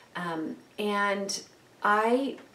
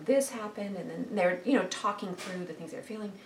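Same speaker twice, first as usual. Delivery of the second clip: normal talking voice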